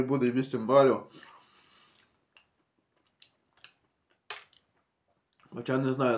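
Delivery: eating and talking; talking while chewing. Real, not acted. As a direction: mouth partly full of cookie. Seen 1: Holds bitten cookie, chews between phrases, wipes crumbs from lips.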